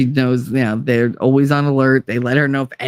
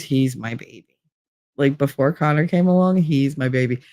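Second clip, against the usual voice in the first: ordinary talk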